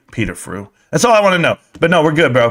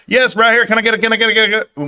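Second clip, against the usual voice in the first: raised, repetitive auction chant; mock auctioneer; 4 semitones higher, faster than usual. Streamer trying out being an auctioneer, mock bid-calling chant. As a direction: rattle it off like taking bids. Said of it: "could I ever be an auctioneer?".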